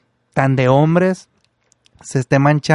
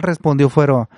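plain reading voice

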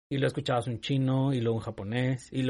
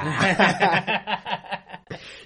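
loud burst of laughter; 7 semitones higher, 5 dB louder than usual. Real, not acted. Reacts to accent teasing.

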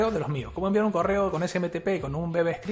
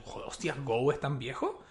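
whispered surprise, much softer; hushed quote; 4 semitones lower, 8 dB quieter than usual. Retold or imitated. Retelling his own thought on Go's age, whispered. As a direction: breathe it out like a private shock. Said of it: "Yo digo".

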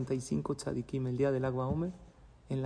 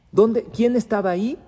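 much louder, higher, urgent panic; panicked questions; 6 semitones higher, 9 dB louder than usual. Acted. A father fearing his son was there, in loud panic. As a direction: demand it as dread rises.

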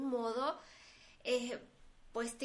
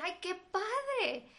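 mock-impressed, drawn-out higher exclamation; imitated admiration; 7 semitones higher, much slower than usual. Host quoting people who hear about home office, imitated admiration. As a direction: imitate an admiring reaction, stretched and raised.

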